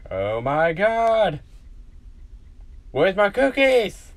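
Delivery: mock childish wail for cookies; excited outcry. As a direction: cry out for cookies, rising to a loud high demand. Child demands cookies in acted scene.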